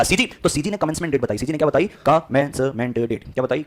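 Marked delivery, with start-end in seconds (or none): 2.1-3.2 drawn-out, very slow dictation of the term; syllable by syllable. Shown as a demonstration. Teacher drilling a new legal term for the class, syllable by syllable. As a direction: break the word into slow, separate beats.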